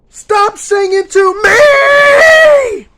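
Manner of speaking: full-voiced high scream, last word held long; screamed demand. Real, not acted. Fed-up child yelling at dad about his singing, screamed demand. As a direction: scream it out, stretching the final word. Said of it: "I'm gonna say this to my dad".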